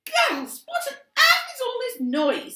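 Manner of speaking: high, loud shrieking mum; scolding mother. A narrator's reading. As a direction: storm in and scold.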